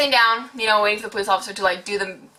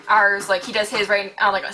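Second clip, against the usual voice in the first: ordinary talk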